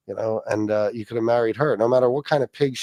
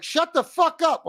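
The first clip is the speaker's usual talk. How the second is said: high-pitched mock wife voice, drawn out; acted irritated wife; slower than usual. Wife trying to sleep scolds ranting husband, mock irritation. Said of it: "She's like".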